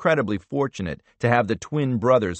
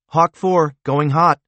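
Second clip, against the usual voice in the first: urgent radio call; 6 semitones higher, 6 dB louder, slower than usual. A narrator's reading. Radio relay.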